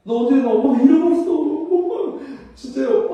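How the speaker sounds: excited reunion. Acted. Mother and lost child recognise each other, excited reunion. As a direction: blurt it out in mock amazement.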